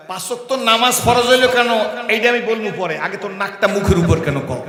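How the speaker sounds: loud, sharp rebuke of a fool; hammed-up scholar's retort; stern scolding. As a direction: scold him loudly, mockingly.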